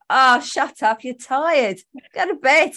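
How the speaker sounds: playful snap at your own brain; exasperated self-scolding. Retold or imitated. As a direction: tell yourself off, half laughing by the end. Acts out scolding own racing thoughts.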